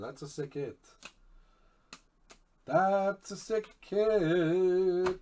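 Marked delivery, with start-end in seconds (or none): 2.6-5.2 high, loud and slowly drawn out; excited savoring. Real, not acted. [name] admiring Doug's sick hit, drawn-out excitement. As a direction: stretch out SICK and HIT loudly, with relish.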